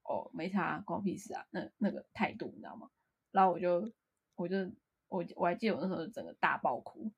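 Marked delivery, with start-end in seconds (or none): 0.0-1.4 softer couldn't-care-less shrug; imitating a friend's softer indifferent shrug; dismissive attitude. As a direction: brush it off like it's none of your business. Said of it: "你一副就是那个".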